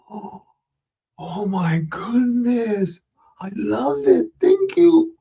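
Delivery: slow then high thankful; delighted child. Acted. Ricky thanking Santa in delight. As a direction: gush with delight.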